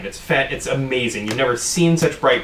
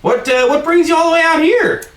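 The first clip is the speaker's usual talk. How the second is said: excited bartender; 5 semitones higher, 4 dB louder than usual. Acted. Bartender gushing to hometown guest.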